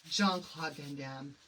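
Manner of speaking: slow, low, seductive name drop; sexy voice. Shown as a demonstration. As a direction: breathe the name out like a come-on. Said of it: "you have to say that name with a sexy voice".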